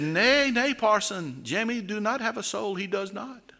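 a hint of a boy stubbornly refusing; stubborn village boy. Village idiot to the parson, mildly stubborn denial. Idiot denies having soul.

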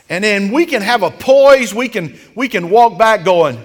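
rising sermon fervor; full-force preaching. Acted. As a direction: preach it up to the rafters.

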